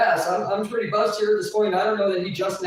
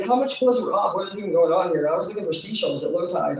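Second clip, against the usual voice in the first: normal talking voice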